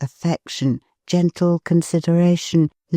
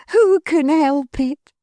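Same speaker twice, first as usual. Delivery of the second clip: higher, slow, fond wife's cry; Missus Tetterby's cry; 10 semitones higher than usual. Acted. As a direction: echo her husband warmly. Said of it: "cried Missus Tetterby".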